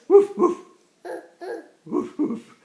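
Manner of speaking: loud paired woofs; dog bark. Pretend. Parent playing dog for a child, loud woofing. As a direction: bark in short punchy bursts.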